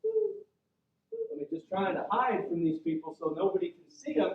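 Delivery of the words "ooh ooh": high little frightened hoots; timid high-pitched "ooh, ooh"; cowering "ooh, ooh". Retold or imitated. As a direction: squeak it like someone nervously hiding. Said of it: "kinda going".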